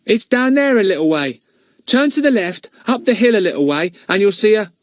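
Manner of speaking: put-on high boyish voice; eager lad pointing the way; Ern helpful. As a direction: Ern gives the driver directions. Ern gives directions.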